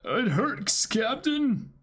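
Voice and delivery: high whine. Acted. Voicing whiny crew.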